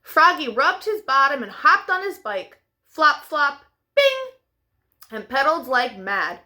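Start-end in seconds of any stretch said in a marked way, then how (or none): 3.9-4.3 bing. A narrator's reading. Bike sound effect.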